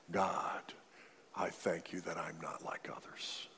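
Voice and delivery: soft whispered mock prayer; whispered boast. Mocking the bragger's prayer to God, whispered.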